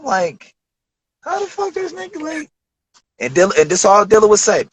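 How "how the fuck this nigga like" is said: higher, softer amazed question; can't believe they know him; stunned disbelief. Retold or imitated. Young producer stunned the stars know him, high and hushed. His amazed reaction retold.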